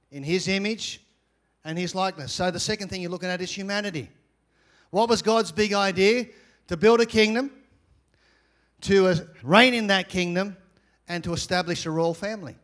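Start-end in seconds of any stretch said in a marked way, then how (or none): none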